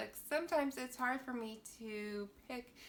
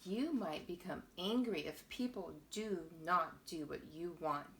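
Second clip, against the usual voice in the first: usual speaking voice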